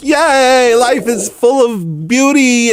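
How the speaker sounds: put-on very high, gleeful kid voice, drawn out; hammed-up childlike glee while running free; joyful child. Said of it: "those joyful moments as a child".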